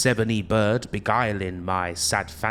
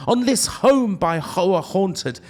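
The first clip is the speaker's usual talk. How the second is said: much higher and louder, begging; desperate pleading cry; 8 semitones higher, 4 dB louder than usual. Acted. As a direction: implore with rising desperation. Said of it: "said I".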